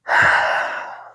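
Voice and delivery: loud exasperated exhale; a heavy sigh for effect. Acted. Retelling her reaction to the plan, sighing. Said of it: "She just sighs like".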